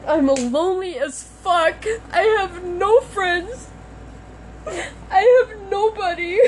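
slow, high and tearful, voice breaking; real tears pushing through each word; crying. Real, not acted. Confessing loneliness while upset.

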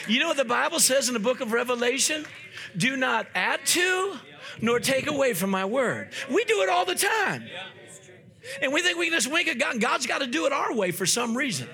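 high, indignant-sounding preacher's rebuke; forceful preaching. Real, not acted. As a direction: quote it emphatically, then cry out the charge. Preacher quotes scripture and rebukes.

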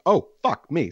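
high startled outburst; shocked curse. Retold or imitated. Retelling his reaction to the fallen woman, high startled curse. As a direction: blurt it out in sudden alarm.

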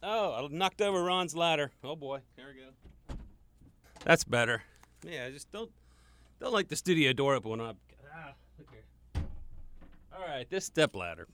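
distant asides while dealing with a knocked-over ladder; off mic. Real, not acted. Away from mic moving the ladder.

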